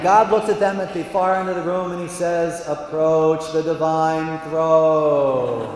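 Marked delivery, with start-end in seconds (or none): 2.7-5.8 booming, intoned divine summons; God. Acted. God from his throne, slow and majestic. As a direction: draw each word out grandly, almost chanted. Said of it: "So that's how he talks".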